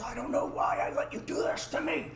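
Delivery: high, strained half-whispered panic; panicky friend. Retold or imitated. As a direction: imitate a friend quietly falling apart. Panicking friend's reply, voiced.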